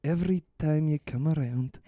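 slow, drawn-out mock Scottish lyric; Scottish accent. Taking the Scottish lyric dare, slow mock Scottish. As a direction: stretch each word in a hammed-up Scottish brogue. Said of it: "in a Scottish accent".

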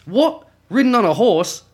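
put-on high, indignant English accent, shouting the coconuts line; mock English outrage. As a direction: play an outraged English guard from Monty Python. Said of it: "good on the accent, mate".

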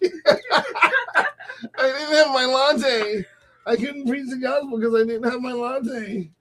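high, slow, pretend-crying complaint; hammed-up pitiful whine; mock whining. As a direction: whimper it like a spoiled excuse-maker. Mocking pampered believer's petty excuse.

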